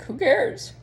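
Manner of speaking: high, loud throwaway "Who cares?"; dismissive inner voice. Retold or imitated. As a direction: fling it off like it means nothing. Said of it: "Then it's like".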